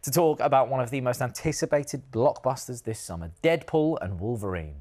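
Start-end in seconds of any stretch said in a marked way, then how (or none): none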